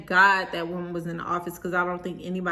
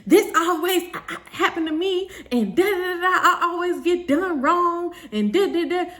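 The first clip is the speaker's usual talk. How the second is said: mocking whine; 8 semitones higher than usual. Bridesmaid complaining she's always done wrong, mocking whine. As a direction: mimic a self-pitying wail, half laughing.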